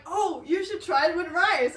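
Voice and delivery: eager suggestion. Retold or imitated. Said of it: "She was like".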